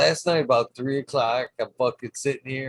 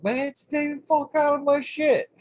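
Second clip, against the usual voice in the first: high scolding. Retold or imitated. Scolding the toad to stay out of his things, high-pitched. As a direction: scold the toad in a much higher voice. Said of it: "I'm like".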